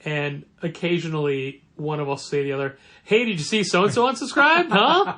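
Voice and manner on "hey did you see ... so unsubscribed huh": gossipy excited. Retold or imitated. Acting out coworker gossip.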